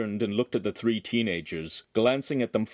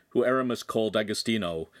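plain reading voice

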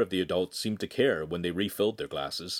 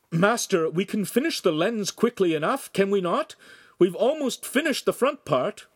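raised, theatrical earnest appeal; dramatically; 10 semitones higher, 4 dB louder than usual. Acted. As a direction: ham up the loyal apprentice. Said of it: "said dramatically".

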